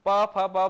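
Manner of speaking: "प, फ, ब, भ" popped loud off the lips; loud lip sounds for "प, फ, ब, भ"; lip sound demo. Shown as a demonstration. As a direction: pop "प, फ" hard off the lips. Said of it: "ओष्ठ ध्वनियां".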